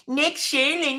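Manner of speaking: high, put-on whiny voice saying the name; hammed-up whiny impression of the critic; mocking whine. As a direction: sneer the name in a mock voice. Mocking the clip's host.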